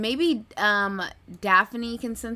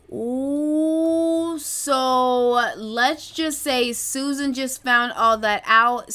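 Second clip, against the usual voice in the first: loud, slow, drawn-out build-up; hyped, dragging each word out loud; building excitement; 3 semitones higher, 6 dB louder, much slower than usual. Real, not acted. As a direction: stretch it out with rising glee.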